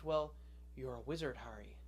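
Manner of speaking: put-on low, soft reveal to a boy; lightly voiced Hagrid. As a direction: drop low and quiet as a hint of Hagrid. Hagrid's line to Harry acted out.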